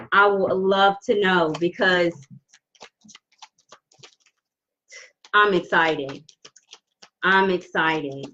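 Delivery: low, slow, long pauses; talking slow, holding back tears. Real, not acted. Reader moved by a client's good news, trying not to cry. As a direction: hold back real emotion between phrases. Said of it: "I'm talking all slow".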